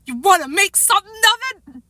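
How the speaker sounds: high, hammed-up challenge; tough guy voice. A narrator's reading. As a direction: play a little kid talking tough. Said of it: "in his best tough guy voice".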